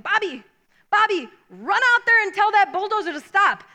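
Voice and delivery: loud, urgent order to run; urgent call. Retold or imitated.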